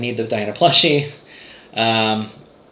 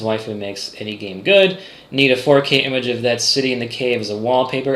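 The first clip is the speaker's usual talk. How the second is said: ordinary talk, nothing marked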